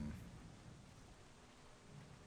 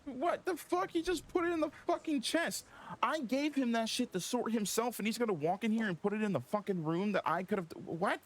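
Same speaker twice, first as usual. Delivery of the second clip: real exasperated shock, much higher; shocked outburst; 13 semitones higher than usual. Real, not acted. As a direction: voice shoots way up in real disbelief. Furious disbelief at teammate's move.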